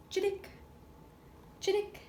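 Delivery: high quick chirps; little sparrow jingle. Shown as a demonstration. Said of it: "Sparrow makes a simple jingle".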